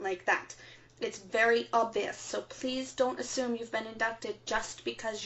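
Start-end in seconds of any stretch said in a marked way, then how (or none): none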